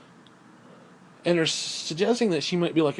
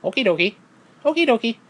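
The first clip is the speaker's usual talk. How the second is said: rising high, louder Luigi voice; Luigi okie dokie; 7 semitones higher, 5 dB louder than usual. Retold or imitated. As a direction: chirp it like a pleased Luigi. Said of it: "and goes".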